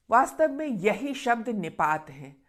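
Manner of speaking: ordinary delivery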